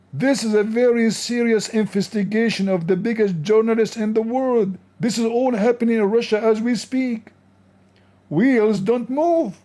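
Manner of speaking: high, hammed-up announcer of a grand discovery; sarcastic mock awe. Mocking the famous journalist to his viewers, sarcastic awe. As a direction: oversell it like world news.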